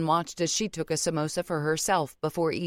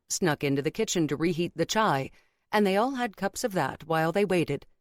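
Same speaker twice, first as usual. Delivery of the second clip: plain reading voice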